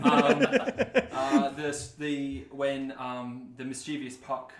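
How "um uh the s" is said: laughing. Real, not acted. Laughs after spoiler joke.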